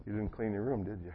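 teasing dad, hushed and soft; whispered. Retold or imitated. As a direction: murmur it close into her ear. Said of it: "I just whispered".

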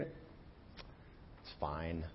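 a breathed, voiceless aside; mock whisper. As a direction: whisper it like a smug secret. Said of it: "He figured".

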